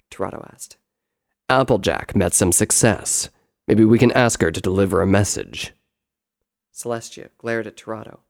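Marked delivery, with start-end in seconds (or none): 1.5-5.7 loud, low brother's voice; deep, forceful elder-brother character; explaining brother. Acted. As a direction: lay it out firmly, pitched down. Brother makes a suggestion.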